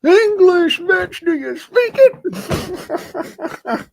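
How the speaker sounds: very high, drawn-out squeal; shrieking parody of a rude demand; mock meme voice. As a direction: stretch every word in a cartoonish high pitch. Quoting a meme voice.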